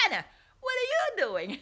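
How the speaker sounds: high, loud, quick mock English accent; put-on English accent. Manager checking on her at work, put-on English accent. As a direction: imitate a bad try at an English accent. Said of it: "trying to put on this English accent".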